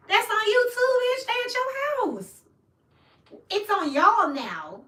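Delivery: high, loud telling off; calling out. Real, not acted. Telling the querent off, loud and high. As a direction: throw it right back at them, loud and high. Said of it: "telling Taurus off".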